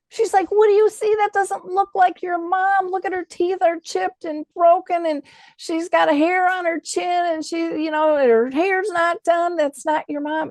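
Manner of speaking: put-on high, insistent older woman's voice, slowing on the hair; voicing Kay. Kay insisting the photo isn't the mother, high and insistent. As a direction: act out a bewildered old lady insisting it's not her mom.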